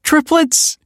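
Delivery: high, loud joyful cry; overjoyed announcement; joyous proclaim. A narrator's reading. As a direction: burst out with joy at the news. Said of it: "proclaimed Sophia joyously".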